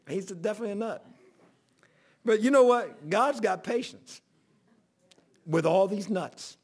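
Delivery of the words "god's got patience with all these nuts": normal talking voice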